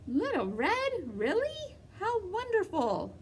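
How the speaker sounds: put-on old grandmother voice. Grandmother voiced, high and delighted.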